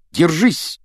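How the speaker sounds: high-pitched cry to hold on; high, urgent exclamation; shouted warning. A narrator's reading. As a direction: call out a sudden urgent warning. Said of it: "крикнул Рон".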